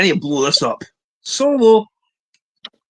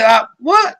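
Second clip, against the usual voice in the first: higher-pitched mock confusion; imitating puzzled listener; 6 semitones higher than usual. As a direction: mimic someone not understanding, rising at the end. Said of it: "he'd be like".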